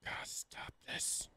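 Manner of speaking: slow frightened whisper; scared whisper. Real, not acted. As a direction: plead under your breath.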